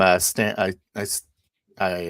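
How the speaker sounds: normal talking voice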